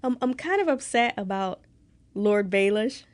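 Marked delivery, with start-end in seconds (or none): none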